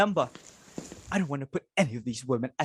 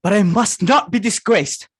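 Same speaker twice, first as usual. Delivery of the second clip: insistent; 4 semitones higher, 7 dB louder than usual. Acted. Mother insists in panic.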